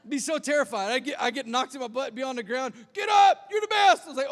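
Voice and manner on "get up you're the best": big, high imitation of a shouting dad; dad yelling. Dad to son knocked on the ground, yelling. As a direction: bellow encouragement across the field. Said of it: "he'd be yelling at me".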